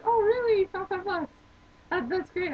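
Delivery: high retold gush, starting loud; mock-amazed, pitched high; gushing surprise. Hostess reacting to coworker's five bucks, gushing surprise.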